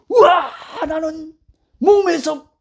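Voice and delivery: high-pitched cry of joyful realization, volume reined in; elated mock holler, not full volume; held-back shout. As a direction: act out wanting to yell it. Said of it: "라고 막 소리 지르고 싶어요".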